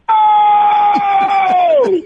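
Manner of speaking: loud excited yelp, high; excited shout. Retold or imitated. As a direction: shout in pure delight. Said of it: "'cause I probably went".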